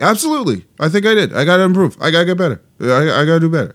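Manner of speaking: high-pitched, earnest mimicked admission; honest coach imitation. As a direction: imitate him high, owning up readily. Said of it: "he's so honest".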